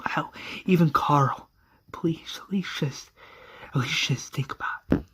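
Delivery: hushed desperate plea; pleading whisper. Retold or imitated. Lori pleading with Shane.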